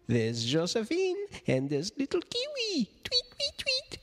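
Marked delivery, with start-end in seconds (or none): 3.0-4.0 very high chirping tweets; bird tweets. Acted. Reader voicing the bird, tiny high chirps.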